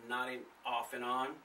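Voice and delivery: ordinary delivery, nothing marked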